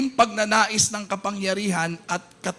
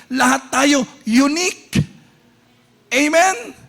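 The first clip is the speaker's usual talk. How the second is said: preacher fired up telling everyone they are beautiful; full-force preaching; 2 semitones higher, 6 dB louder than usual. Acted. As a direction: lift the congregation, punch each line.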